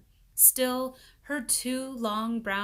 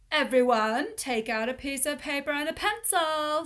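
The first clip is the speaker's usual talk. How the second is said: teacher voice; 6 semitones higher, 7 dB louder than usual. A narrator's reading.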